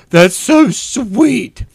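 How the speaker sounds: high, very drawn-out gush; gushing coo. Retold or imitated. Viewer reacting to the sister's strawberry story, high slow coo. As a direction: coo it slowly, high-pitched.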